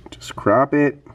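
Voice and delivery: ordinary talk, nothing marked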